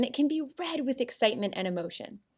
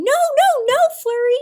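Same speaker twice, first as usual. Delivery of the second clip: much higher, louder frightened scream; fearful yell; 10 dB louder than usual. Shown as a demonstration. Teacher demos a yell that needs an exclamation point. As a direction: scream it in fear for the puppy. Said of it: "I was yelling".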